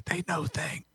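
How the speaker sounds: hushed, unvoiced whisper; whispered warning. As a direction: whisper it like a dangerous secret. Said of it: "he whispers to you".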